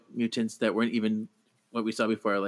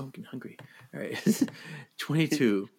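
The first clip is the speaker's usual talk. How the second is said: ordinary delivery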